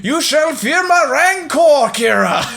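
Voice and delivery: slow, high, loud theatrical declaration; hammed-up movie line. Quoting Asano in the boring movie, slow hammed-up roar. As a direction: declaim it like a goofy film hero. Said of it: "He's like".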